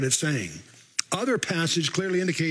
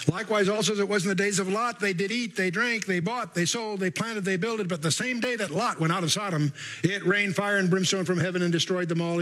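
higher, even-pitched Bible reading; scripture reading; 4 semitones higher than usual. Acted. Preacher reads Luke seventeen to the congregation, scripture reading. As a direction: read the verse out as one driving recital.

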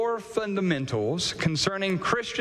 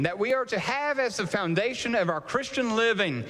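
ordinary talk, nothing marked

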